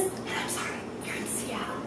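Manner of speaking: very soft, near-whispered aside; hushed disbelief. Retold or imitated. Patient amazed the doctor is local, hushed disbelief. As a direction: whisper it as if you can't believe it.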